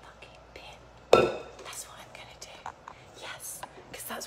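a whispered aside to viewers. Real, not acted. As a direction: whisper it like a naughty confession. Confiding rude kitchen tip.

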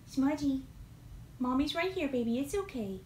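calm the frightened kitty gently; soothing a pet. Real, not acted.

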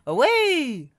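high, loud, drawn-out call; storybook shout. A narrator's reading. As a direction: cry out as if galloping off. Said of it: "Spud shouted".

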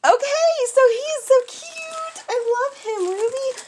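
high, sing-song cooing; cooing delight. Real, not acted. Cooing over the cute bear Ruby sent, high-pitched. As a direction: coo over the cute toy.

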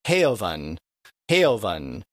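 "heaven" drawn out as "HAY-uh-vun"; stretched-out vowel. Shown as a demonstration. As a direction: break the first vowel of "heaven" into a long diphthong. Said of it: "it's stretched out instead of heaven".

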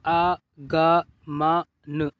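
आगमन slowly, each letter apart; sounded out. Shown as a demonstration. Teacher showing learners easy reading, आगमन sounded out. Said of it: "इस तरीके से आप पढ़िए".

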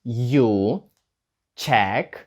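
slow, word-by-word question. Shown as a demonstration. Teacher modelling the question step by step. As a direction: build the question one word at a time. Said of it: "did іде на початок".

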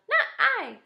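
very high, loud little mouse; mouse's line. A narrator's reading. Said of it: "said the mouse".

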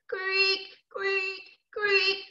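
high, stretched creaking noise; strange rope creak. Acted. Storyteller voicing the old swing rope, high creaks. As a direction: imitate an old rope straining, pitched high. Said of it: "began to make a very strange noise".

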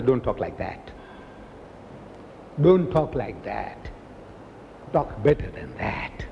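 hushed, low admonishing; slow right down and softly reprove; soft, drawn-out correction, nearly whispered by the end; gentle reproof. Acted. Allah's reproof acted after the quarrel.

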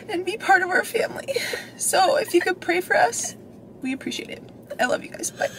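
choked-up whispered plea; crying whisper. Real, not acted. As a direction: whisper through real tears. Mom breaks down in update.

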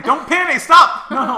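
high, loud panicked cry; panicked yelling. Real, not acted. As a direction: yell in alarm at the game. Watching her almost fall.

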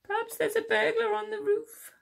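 high small-mouse voice; worried Little Mouse. A narrator's reading. Little Mouse fears a burglar on the roof, high worried squeak. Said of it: "called Little Mouse".

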